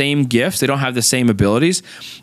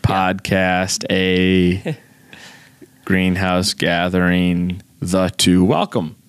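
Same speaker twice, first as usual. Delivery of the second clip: intro said backwards, slow and halting; backwards speech; 5 semitones lower, slower than usual. Shown as a demonstration. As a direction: read the intro in reverse, slow, word by word. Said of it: "What if you just said the intro backwards?".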